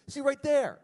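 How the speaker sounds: high, loud imitation of the producer catching him out; retold pouncing correction. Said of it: "He goes".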